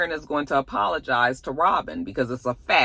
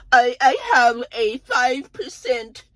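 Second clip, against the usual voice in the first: high, slow, grave revelation; a high, slow, hammed-up confession; dramatic Karen; 6 semitones higher, 4 dB louder, slower than usual. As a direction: stretch out the bad news for effect. Karen revealing her diagnosis.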